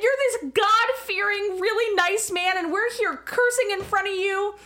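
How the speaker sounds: high-pitched, pleading objection; incredulous protest. Retold or imitated. Acting her embarrassed protest to contractor.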